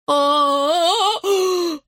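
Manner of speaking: high scared scream; villagers screaming. Acted. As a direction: scream in panic. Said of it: "закричали селяне".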